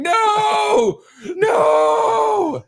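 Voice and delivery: loud, high-pitched wail of refusal; toddler's protest wail. Retold or imitated. Dad imitating his son reaching out at bedtime, wailed protest. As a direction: imitate a toddler crying out NO, drawn out. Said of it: "he's always reaching out for Garrett, going".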